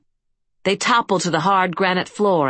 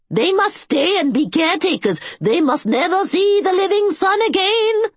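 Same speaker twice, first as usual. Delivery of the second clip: very high, insistent cry of the keeper; shrill panic about the tombs; frantic old caretaker; 13 semitones higher, 4 dB louder than usual. A narrator's reading. Agamon pleading with the king.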